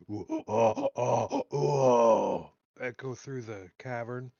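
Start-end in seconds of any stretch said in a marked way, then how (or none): 0.1-2.5 Yeti call. Retold or imitated. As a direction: howl it out like a Yeti calling. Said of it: "a deeper Yeti voice, like, yell out".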